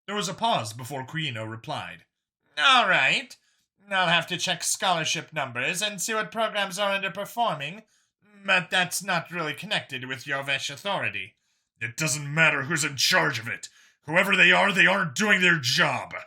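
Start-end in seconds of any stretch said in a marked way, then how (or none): 2.6-11.4 higher, female official, cautious; Kuino's voice. Acted. Official answering Vincent over the call, higher voiced. 11.8-16.2 pressing each point, getting louder; stressed insistence. Acted. Vincent pressing the official on the call, louder and insistent. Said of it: "Vincent stressed".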